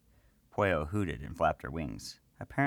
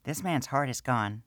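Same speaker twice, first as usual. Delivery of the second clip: high small gnome woman's voice, matter-of-fact; gnome priestess; 7 semitones higher than usual. Acted. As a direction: speak as the little priestess. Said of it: "Minerva clarified with a frown".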